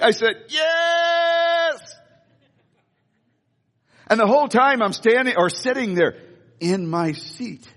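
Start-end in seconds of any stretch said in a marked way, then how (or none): 0.5-2.0 a high, thrilled shout of yes; excited yes. Retold or imitated. Pastor accepting tickets to the home opener, shouting with joy. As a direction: burst out yes like a kid given a gift.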